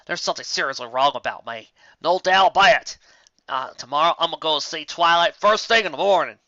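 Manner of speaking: loud, high, rattled self-talk; troubled outburst. Acted. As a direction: burst out in worry about yourself. Applejack worried aloud.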